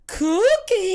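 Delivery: big high bellow for the cook; summoning shout at full volume; Trunchbull. Acted. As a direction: holler it out long and very loud. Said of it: "Trunchbull's like".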